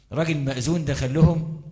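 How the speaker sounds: ordinary delivery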